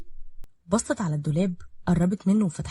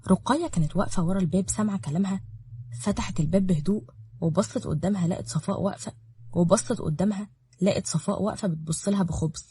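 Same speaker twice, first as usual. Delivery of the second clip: usual speaking voice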